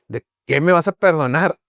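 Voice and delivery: hint of an indignant dad pushing back; defensive father. Son tells his father he forgives him, indignant.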